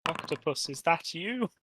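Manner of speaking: muffled behind a door; through a wall. Pretend. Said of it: "There's a very muffled voice".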